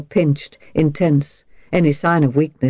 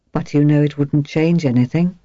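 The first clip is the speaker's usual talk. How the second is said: ordinary delivery, nothing marked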